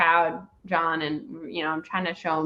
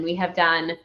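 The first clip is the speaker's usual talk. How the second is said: usual speaking voice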